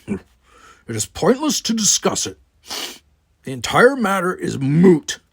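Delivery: a proud Klingon brushing the talk aside; Worf's voice. A narrator's reading. Worf, Klingon, dismisses the matter.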